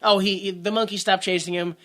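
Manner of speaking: ordinary delivery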